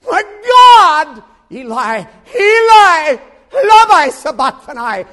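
high screaming cry, slowing on the last words; agonized wail. Acted. Jesus crying Eli Eli on the cross, screamed. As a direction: wail it in Aramaic.

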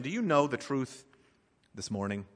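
normal talking voice

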